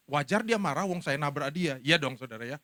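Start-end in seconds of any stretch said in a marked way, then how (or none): none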